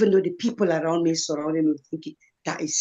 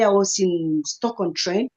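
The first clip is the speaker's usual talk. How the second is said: usual speaking voice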